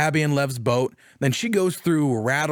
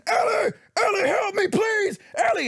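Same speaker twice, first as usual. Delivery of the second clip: high desperate screams for help, much higher; retold desperate screaming. Joel dying, screaming for Ellie to help. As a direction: cry out for help in panic. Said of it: "Joel is screaming".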